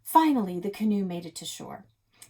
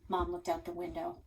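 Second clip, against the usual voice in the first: usual speaking voice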